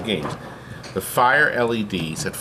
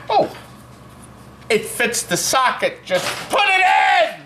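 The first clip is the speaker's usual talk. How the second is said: mock shout; 10 semitones higher than usual. Mocking careless repair techs.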